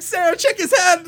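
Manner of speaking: high, loud urgent call to his wife; frantic call. Retold or imitated. Calling to his wife about the baby's head, frantic. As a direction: yell to Sarah in a panic. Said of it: "and I'm like".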